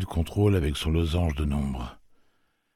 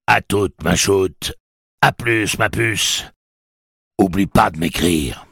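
louder, sing-song mock goodbye; mocking farewell; 5 semitones higher, 8 dB louder, slower than usual. Blaine mocks the travellers: louder, sing-song goodbye. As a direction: taunt them with a cute farewell.

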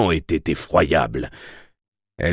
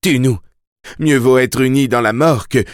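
véhémence suppliante; 5 semitones higher, 4 dB louder than usual. A narrator's reading. Mia supplie en secouant Susanna.

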